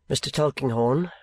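ordinary delivery, nothing marked

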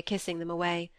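ordinary delivery

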